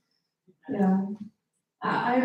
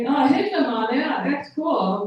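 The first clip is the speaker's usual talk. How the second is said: put-on higher German-accented gushing; mock German accent; 3 semitones higher, slower than usual. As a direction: parody the German's enthusiasm back at him. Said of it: "talking to this German guy".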